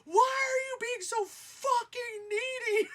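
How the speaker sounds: high, loud, slow yell at the boring love interest; retold scream. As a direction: scream it at the screen, exasperated. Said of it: "I screamed at him and said".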